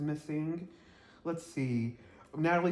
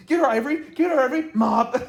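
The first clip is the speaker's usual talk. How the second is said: high, loud mock yell; excited cheering; 9 semitones higher, 11 dB louder than usual. Fan cheering Ivory on in a fight, excited yelling. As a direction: whoop her on like a rowdy fight fan.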